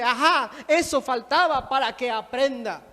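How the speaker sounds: loud, mocking satisfaction; gloating. Resentful believer seeing the offender suffer, gloating loudly. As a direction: crow loudly over someone's misfortune. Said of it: "se ríe y dice".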